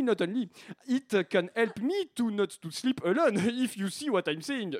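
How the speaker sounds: hammed-up French accent in English. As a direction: speak English like a self-satisfied charming Frenchman. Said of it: "c'est l'accent français". (anglais à fort accent français)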